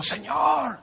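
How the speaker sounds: high, soft plaintive cry; retold plea. Pastor retelling his prayer to God, plaintive plea. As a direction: plead upward to the Lord. Pleading to the Lord.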